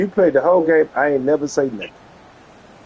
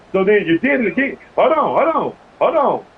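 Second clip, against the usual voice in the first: ordinary delivery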